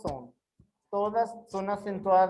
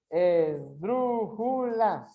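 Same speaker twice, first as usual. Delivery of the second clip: very slow, drawn-out syllables; much slower than usual. Shown as a demonstration. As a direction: stretch the word out for a class. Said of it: "separa em sílabas". (sílaba por sílaba, bem devagar)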